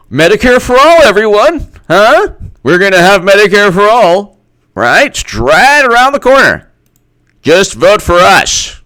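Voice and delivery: high, loud hammed-up sales pitch; exaggerated pleading campaign promise; mock campaign pitch. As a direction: shout it like an overeager politician. Mimics Democrats pitching to voters.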